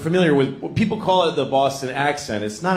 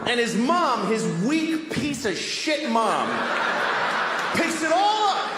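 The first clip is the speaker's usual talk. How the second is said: furious rant; 10 semitones higher, slower than usual. Acted. Ranting about a weak mom.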